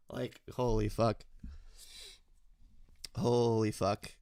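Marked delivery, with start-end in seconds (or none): none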